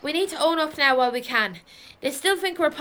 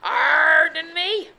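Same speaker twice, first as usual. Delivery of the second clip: drawn-out pirate "arr" in the first word; slow, rising pirate-accent question; 4 dB louder, slower than usual. Acted. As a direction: correct yourself into hammed-up pirate accent. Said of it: "I mean".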